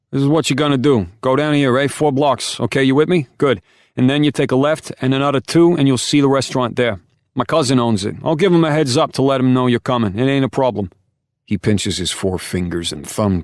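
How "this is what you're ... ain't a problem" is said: big, high, loud New York Italian directions; New York Italian accent. Acted. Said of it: "thick New York Italian accent".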